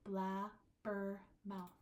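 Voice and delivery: very slow, "Blabbermouth" split into three syllables. Shown as a demonstration. Teacher splitting a word for counting, slow separated syllables. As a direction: break "Blabbermouth" into blab, ber, mouth. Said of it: "Three vowel sounds".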